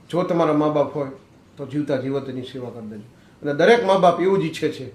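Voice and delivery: loud, ringing sermon voice, climbing to peaks; fervent preaching. Acted. Preaches moral to audience.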